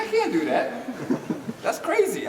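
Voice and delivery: high, laughing refusal; excited protest. Retold or imitated. Street dancer refusing his teacher's counting, high and laughing. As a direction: toss it off as crazy, pitch way up.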